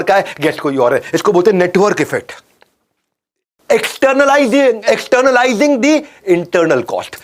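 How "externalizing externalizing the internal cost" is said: drawn out syllable by syllable, pitch raised; slow, emphatic lecturer's enunciation. Shown as a demonstration. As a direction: spell out the term slowly and deliberately. Spelling out a key term.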